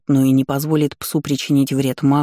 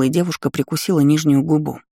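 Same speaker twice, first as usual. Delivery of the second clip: plain reading voice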